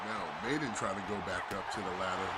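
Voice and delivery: excited live play-by-play; wrestling commentator. Acted. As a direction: call the match live. Live play-by-play of ladder match.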